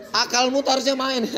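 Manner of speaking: high-pitched reproach, amused; hammed-up lecture to a little child; mock scolding. Shown as a demonstration. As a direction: imitate a wordy telling-off. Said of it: "kita marahi anak T K".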